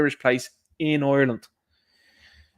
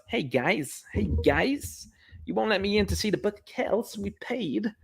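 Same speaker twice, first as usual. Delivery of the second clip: higher, softer put-on American voice; imitated American tourist; 6 semitones higher, 5 dB quieter, slower than usual. American tourist refused entry to Book of Kells, imitated American accent. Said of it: "it was an American fella".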